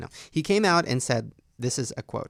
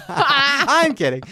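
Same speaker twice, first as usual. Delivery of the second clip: laughing through the words; 12 semitones higher, 9 dB louder, much slower than usual. Real, not acted. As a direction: giggle it out. Laughing after a joke.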